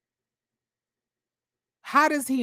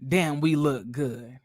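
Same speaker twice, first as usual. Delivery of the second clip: imitating Rocky; 7 semitones lower, 4 dB quieter, slower than usual. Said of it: "So Rocky saying".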